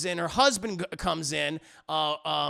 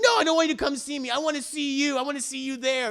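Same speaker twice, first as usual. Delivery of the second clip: higher, quick, insistent retort; insistent pleading; 6 semitones higher, faster than usual. Retold or imitated. Chuck argues to visit Rufus, insistent pleading. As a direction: press the demand in a raised pitch. Acted lovers' quarrel on phone.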